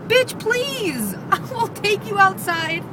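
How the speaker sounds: high-pitched, sassy retold comeback; hammed-up "try me" attitude; sassy mock threat. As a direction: play up a challenge, laughing midway. Retold comeback to dirty looks.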